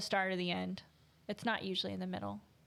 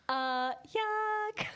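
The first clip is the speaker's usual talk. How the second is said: high squeaky mock yuck; mock disgust; 12 semitones higher than usual. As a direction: echo yuck in a silly high voice.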